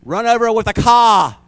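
a high, loud jeer at the woman; mean crowd shout. Acted. Mob jeering at the accused woman, loud and high. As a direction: yell it like a hostile mob. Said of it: "giving her the Boston eye".

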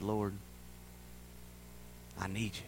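very soft, low plea; hushed prayer. Retold or imitated. Daughter opening up to the Lord, imitated hushed prayer. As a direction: pray it almost under the breath. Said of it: "she opened up and said".

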